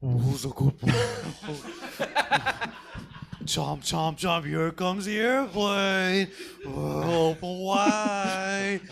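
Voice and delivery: put-on sing-song baby talk, slow; talking to a toddler. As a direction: coo like feeding a baby. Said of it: "I am a fussy toddler".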